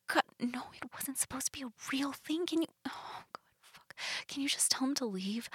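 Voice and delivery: hushed urgent whisper. Acted. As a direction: whisper it, pleading softly. Whispered plea to partner.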